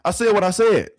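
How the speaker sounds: loud, high, daring anyone to argue; defiant shout. Real, not acted. Streamer to chat, shouting his hot take. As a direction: belt it out like a final verdict.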